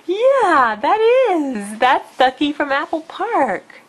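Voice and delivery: high, loud delighted talk. Real, not acted. Excited baby talk.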